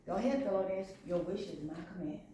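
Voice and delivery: low, hushed granting voice; soft wish-granting. Acted. Princess Fish granting cars, soft and low. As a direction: let it fall soft and low.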